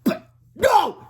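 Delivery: very high, loud outburst; screamed. A narrator's reading. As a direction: scream the refusal. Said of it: "screamed Melvin".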